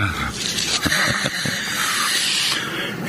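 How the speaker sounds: low breathy villain snicker; sly evil chuckle. Acted. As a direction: chuckle to yourself, gloating over her. Intruder gloats over sleeping girl. (palihim na masamang tawa ng kontrabida)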